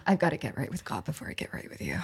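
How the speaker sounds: low, hurried American movie-hero voice; American accent. Acted. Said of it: "I want an American accent".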